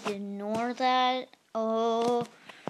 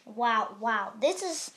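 ordinary talk

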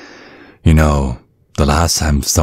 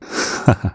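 breathy chuckle; 4 semitones higher, 5 dB quieter than usual. Acted. Creepy dad's soft laugh.

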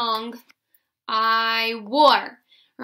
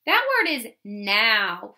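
ordinary talk, nothing marked

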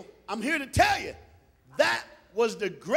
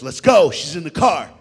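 loud, low fatherly command; impatient dad; 5 semitones lower, 11 dB louder than usual. Retold or imitated. Dad hurrying sons to the car, loud and commanding. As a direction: call out to hurry the kids.

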